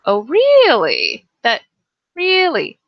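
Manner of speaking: "really?" with a big rising hook, up then down; surprised question tune on "really". Shown as a demonstration. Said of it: "we have something called the questioning tone".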